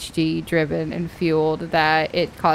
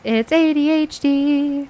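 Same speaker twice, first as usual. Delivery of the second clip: high, slow singsong jingle; sound-alert voice; 6 semitones higher, slower than usual. Shown as a demonstration. Player drafts a sound alert, high singsong. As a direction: draw out the letters in a high chime. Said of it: "make a sound that literally says".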